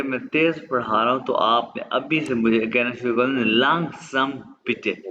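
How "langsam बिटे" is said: langsam बिटे said very slowly; drawn out langsam बिटे; slow request. Shown as a demonstration. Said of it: "langsam है slow".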